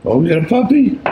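high, thrilled exclamation; excited. Real, not acted. First puppy born.

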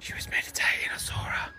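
unvoiced whisper, gossiping; hushed conspiratorial whisper. Real, not acted. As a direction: whisper it behind her back. Gossiping about viewer meditating.

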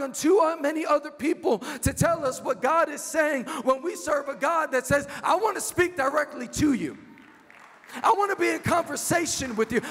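high, impassioned sermon voice; fervent preacher in full flow; full-force preaching. Real, not acted.

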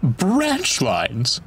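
shuddering. A narrator's reading. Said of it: "they shuddered".

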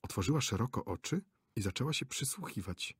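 ordinary delivery

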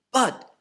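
"But," lifted high and set apart; stressed but. Shown as a demonstration. Preacher marking Jesus' turn to the third-day promise with emphatic "But,". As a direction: punch the word "But," with a pause after.

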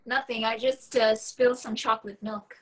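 put-on high, girlish voice for Rachel; young woman's voice. Young woman covering a spill on the phone, high and flustered. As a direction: play the flustered young woman, pitched well up.

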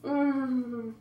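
peeling mask off mouth; speaking with lips pulled; lips stretched by face mask. Real, not acted.

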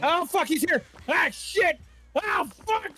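high, loud panicked yelps; panicked screaming. Real, not acted. Killer suddenly appears in game.

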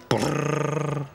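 teeth-chattering brrr; shivering brrr. Acted. Oliver freezing in the snow.

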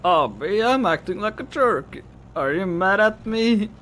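put-on high, pleading drunken whine; whiny drunk girl. Drunk girl apologising to friend, high slurred pleading. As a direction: wheedle with loose, stretched words.

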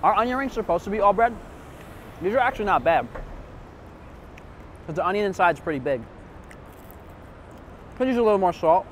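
eating between words; talking while chewing. Real, not acted. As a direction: mouth partly full. Seen 0: Holds onion ring, bites it at 3.9–4.8, jaw chewing throughout.

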